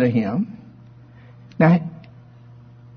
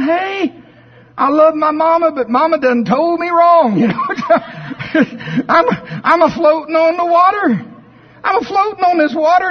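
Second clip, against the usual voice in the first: much higher and louder, delighted with laughs; joyful duckling; 13 semitones higher, 4 dB louder than usual. Acted. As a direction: crow with glee at floating. Chuck floats happily, laughing.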